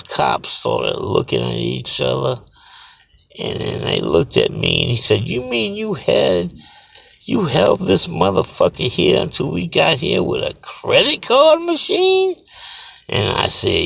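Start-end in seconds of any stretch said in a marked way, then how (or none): 5.3-12.4 a cop who can't believe it, rising to a squeak on machine; incredulous cop. Retold or imitated. Cop asking the guard in disbelief, voice climbing high.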